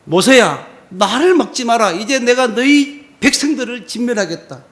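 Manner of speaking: loud, commanding voice of a furious God; God warning Moses, loud and furious; angry God. Acted. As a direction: thunder it out as God. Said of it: "하나님이 진노하셨어요".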